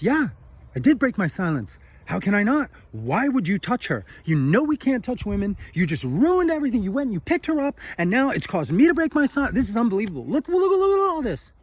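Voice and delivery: angry monk scolding his friend, high and loud; frantic rant. Acted. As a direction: spiral into a wild tantrum.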